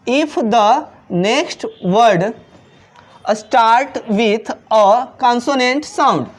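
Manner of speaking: each word drawn out slowly for the class; slow classroom dictation of an English rule. Shown as a demonstration. Teacher slowly reads second rule.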